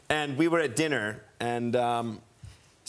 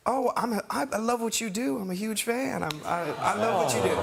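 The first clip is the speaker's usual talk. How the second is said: lightly voiced gentle idol gushing; 8 semitones higher than usual. Voicing [name] praising him.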